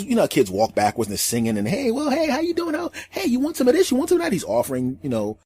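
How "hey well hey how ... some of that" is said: put-on very high, eager kid chatter; little kid voice. The boy greets everyone while offering food, very high and eager. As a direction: bounce it up high like an excited kid.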